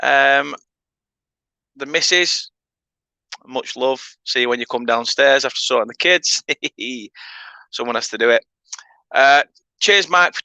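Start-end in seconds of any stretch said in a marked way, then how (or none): none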